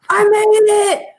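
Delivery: high, loud gleeful yell; triumphant movie shout. Retold or imitated. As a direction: shout it like a victorious escape. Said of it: "and she's like".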